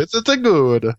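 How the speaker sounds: high mock-Italian voice; fake Italian accent. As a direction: do a bad Italian lilt. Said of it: "when you do the fake Italian accent".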